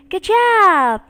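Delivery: normal talking voice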